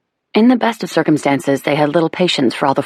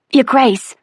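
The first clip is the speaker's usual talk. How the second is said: a high, louder call for attention; urgent maid; 10 semitones higher, 3 dB louder than usual. A narrator's reading. Maid trying to catch the Duchess's attention, high and urgent. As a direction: interrupt politely but urgently. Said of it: "the poor maid was still trying to gain attention".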